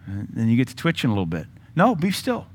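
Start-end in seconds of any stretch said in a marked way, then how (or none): 1.7-2.5 mock scolding. Preacher mock-scolds his twitching mind.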